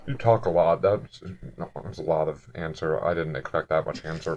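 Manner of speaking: low, soft, measured menace; intimidating questioner. Acted. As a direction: probe quietly with halting pauses. Plays intimidating Harash.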